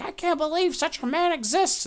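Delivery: very high, loud put-on voice; scandalized mock outcry. Gossips fleeing the yelling old man, high mock outrage. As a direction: squeal it like an appalled gossip. Gossips acted as they run away.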